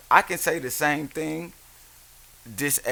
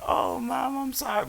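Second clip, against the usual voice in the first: put-on tender, high-pitched sorry; mock sympathetic apology; 11 semitones higher than usual. Imagined apology to crying mom.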